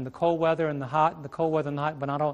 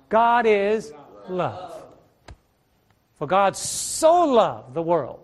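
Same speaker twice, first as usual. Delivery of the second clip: slow, tender emphasis; much slower than usual. Real, not acted. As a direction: stretch each word slowly and gently. Preacher savours God's love.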